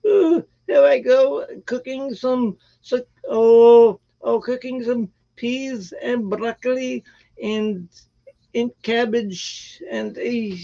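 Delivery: a light, hammed-up British cooking-show accent. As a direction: play a posh British TV cook, lightly. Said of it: "Could I get that British accent down?".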